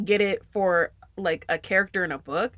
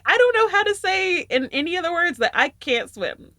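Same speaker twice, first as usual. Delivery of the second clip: laughing; 9 semitones higher, 5 dB louder than usual. Real, not acted. Laughing while retelling refusal.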